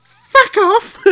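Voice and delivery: posh swearing Queen. Pretend. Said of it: "talk in that accent".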